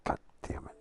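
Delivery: breathy under-the-breath curse; whispered curse. Real, not acted. Streamer whispering a curse after the crash. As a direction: mutter it in defeat.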